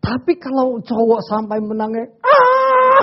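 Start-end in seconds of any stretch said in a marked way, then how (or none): 2.2-3.0 sudden high loud cry; mock wail. Preacher demonstrating a man crying, sudden mock wail. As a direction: let out a startling mock wail.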